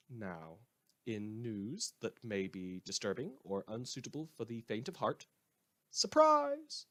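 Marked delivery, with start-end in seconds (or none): 5.9-6.8 mock surprise. Mock cheerful reveal.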